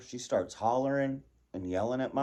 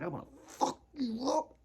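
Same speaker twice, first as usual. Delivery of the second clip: mock threat; 13 semitones higher than usual. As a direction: say it wide-eyed and unhinged. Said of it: "with my eyes like this saying".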